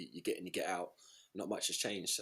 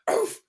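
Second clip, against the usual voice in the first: explosive barking exhale; loud woof-like grunt; 10 dB louder than usual. Retold or imitated. Man at the top of a deadlift, loud barked exhale. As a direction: bark out the breath like a straining lifter. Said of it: "like, loud woof or bark".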